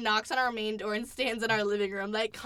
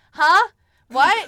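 imitating Thomas, a sudden loud, higher "Huh? What?"; loud confused huh; 7 semitones higher, 13 dB louder than usual. Mimicking her clueless friend, loud and confused. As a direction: blurt it out, loud and baffled. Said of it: "and he's like".

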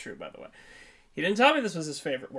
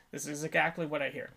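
ordinary talk, nothing marked

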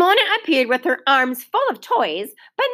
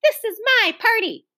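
insistent; 11 semitones higher than usual. A narrator's reading. Ramona insisting.

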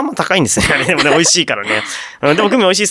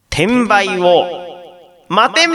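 pun delivery; much slower than usual. Shown as a demonstration. Radio host presents a listener's pun, theatrical pun delivery.

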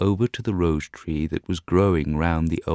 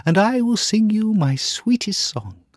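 nightingale pleading; 5 dB louder than usual. Acted. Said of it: "she cried".

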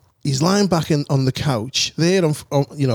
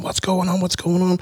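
a touch higher, demanding; landlord demanding; 6 semitones higher than usual. Retold or imitated. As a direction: mildly imitate the landlord's demand. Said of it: "the landlord turned up going".

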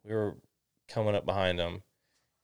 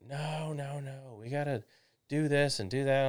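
higher-pitched, insistent dad imitation; objecting dad; 6 semitones higher than usual. Dad rejecting the trailer repair idea, objecting. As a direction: act out dad overruling you.

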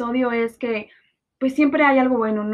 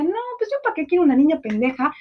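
higher, scornful voice of her ex; ex-boyfriend quoted; 7 semitones higher than usual. Retold or imitated. Quoting her ex-boyfriend dismissing a spoiled girl, higher and scornful. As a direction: scoff at the spoiled girl as the ex did. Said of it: "mi exnovio que decía".

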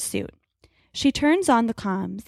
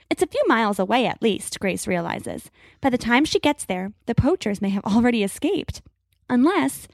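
plain reading voice

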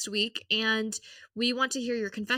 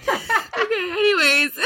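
high, loud laughing; 9 semitones higher, 9 dB louder, slower than usual. Real, not acted. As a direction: giggle through it. Still laughing.

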